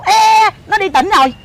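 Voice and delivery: drawn-out, dismissive mock brush-off; snooty mother. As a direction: imitate a mother too important to bother. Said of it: "má nó chảnh lắm".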